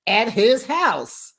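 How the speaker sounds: ordinary talk, nothing marked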